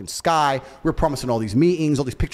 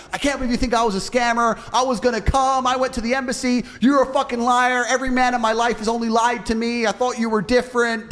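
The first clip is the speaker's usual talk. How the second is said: higher, indignant girlfriend voice, hammed-up a little; mock outraged girlfriend; 8 semitones higher than usual. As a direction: play the wronged girl turning it on him. Acted girl's outraged comeback.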